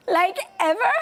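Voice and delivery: put-on dismayed teen. Mom quoting her daughter on hearing there's no internet, dismayed. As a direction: ask it in teen horror. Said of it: "And Lola says".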